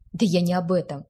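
ordinary delivery, nothing marked

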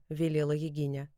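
ordinary delivery, nothing marked

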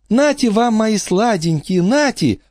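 put-on high squeaky baby talk to dogs. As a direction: coo to your little doggies. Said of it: "пропищала она писклявым голосом".

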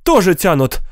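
high-pitched insistent cry; mild protesting yell. A narrator's reading. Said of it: "закричала госпожа Катчинсон".

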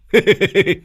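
high, loud mocking guffaw. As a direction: cackle at your own joke. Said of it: "Lâm Sẹo nó cười hô hố". (cười hô hố)

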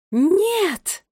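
long, high loud no; alarmed scream. A narrator's reading. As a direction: cry out in horror to stop her. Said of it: "одновременно закричали королева Кникс и Гвардиния". (протяжный крик ужаса)

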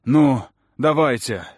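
loud, slow defiant shout. Acted. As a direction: yell it as a dare. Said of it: "закричал Чет".

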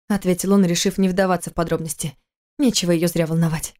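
usual speaking voice

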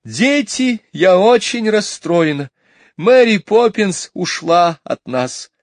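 high, loud, distressed mother voice; a flustered lady breaking bad news; upset mother. A narrator's reading. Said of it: "кричала миссис Банкс".

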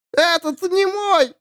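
high howling wail. Acted. Dead woman howls searching for her child. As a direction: howl it like a grieving ghost. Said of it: "выла она".

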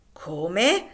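loud, incredulous question; indignant surprise. A narrator's reading. Haley hearing Loker demand fifty dollars, indignant surprise. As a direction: react aghast at the demand.

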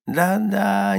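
high, thin, impatient little shaman. A narrator's reading. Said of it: "нетерпеливо ответил маленький шаман". (нетерпеливо, высоким голоском)

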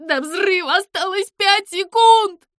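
high, very loud scream; desperate high-pitched alarm; panicked countdown. Acted. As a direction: shriek the time left in terror. Zyza screams final countdown.

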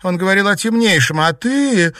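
put-on high, loud young woman; agitated accusation. A narrator's reading. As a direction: confront him with rising alarm. Girl confronts the possessed youth.

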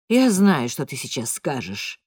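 lightly voiced low father; a low, weary dad at the door; father heading off an argument. A narrator's reading. Father arriving for a quarrel.

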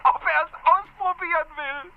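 höher, naiv und arglos, wie die junge Agentin; naive Noah nachgeahmt. Retold or imitated. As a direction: spielt Noahs treuherzige Ehrlichkeit nach. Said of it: "Und was sagt sie?". (Noah gegenüber dem Polizisten, höher und treuherzig nachgeahmt)

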